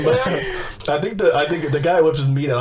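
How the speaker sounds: normal talking voice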